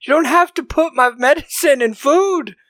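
husband exploding at his wife over the hidden medicine; set off, furious. Retold or imitated. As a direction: blow up at the wife in a hammed-up rage. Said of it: "That sets him off".

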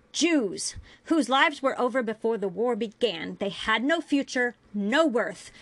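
heated, forceful rant; yelling. A narrator's reading. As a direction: hurl accusing questions at full voice. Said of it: "she yelled".

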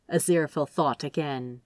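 ordinary delivery, nothing marked

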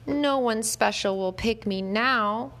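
slow, mournful little whimper; a dejected dog whimpering to himself. A narrator's reading. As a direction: let it sag, slow and defeated. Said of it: "he whimpered".

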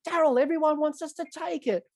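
very high imitated appeal; mock pleading. Batsman appealing to the umpire, mock pleading.

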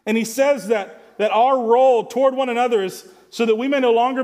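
impassioned preacher; full-force preaching. Real, not acted. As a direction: preach it with rising fervour.

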